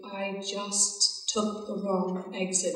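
very slow, hushed admission; drawn-out, softly voiced retold confession; sheepish confession. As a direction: imitate a caller reluctantly owning up. Sheepish caller confesses wrong exit.

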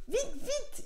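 very high, urgent little squeal; high, anxious urging; urgent hurrying. A narrator's reading. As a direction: hurry him inside in a high-pitched voice. Brother urges pig inside.

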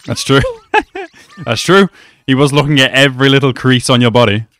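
normal talking voice

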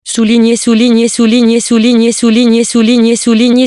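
souligné repeated loud and high like a drumbeat; emphatic repetition. A narrator's reading. Narrator repeats the underlined word.

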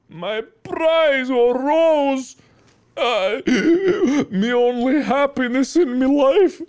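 high, hammed-up Scottish wail with gasps. Man mourning his only happiness, sobbing Scottish. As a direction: lament as a heartbroken Scotsman, breaking into a laugh. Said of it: "I don't know why he's Scottish all of a sudden".

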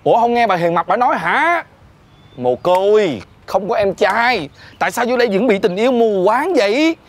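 rising exasperation into a high call; exasperated scolding. Acted. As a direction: try to knock sense into the boss. Exasperated worker argues with boss.

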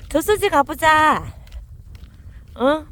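저수지 가보자 drawn out slowly, loud and high, 저수지 weighted most; 저수지 stressed in a slow, loud, high call. Real, not acted. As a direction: stretch the call out long so the dogs hear. Calling the dogs to the reservoir.

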